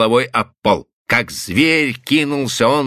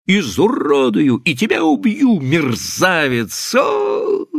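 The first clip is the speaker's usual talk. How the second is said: high threats ending in a piercing wail; shrieking rage; 5 semitones higher, slower than usual. A narrator's reading. Wife raging at her unfaithful husband, shrieking. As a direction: threaten him, stretch the insult, break into a high cry. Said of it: "взвизгнула Эйлин".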